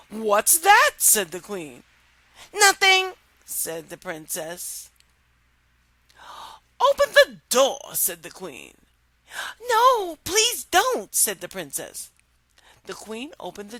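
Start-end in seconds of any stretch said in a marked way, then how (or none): 0.1-0.9 high loud queen. Acted. Queen voiced in story. 2.5-3.1 high loud princess; high hasty denial. Acted. Princess denies frog. 6.8-7.9 high loud order; high loud queenly order. Acted. 9.6-11.1 high loud plea; high loud begging princess; pleading princess. Acted. Princess begs queen.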